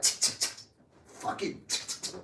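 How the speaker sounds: whispered tongue clicks; mock tsking. Mimicking relatives clicking tongues in disapproval. Imitating relatives' dismissive reaction.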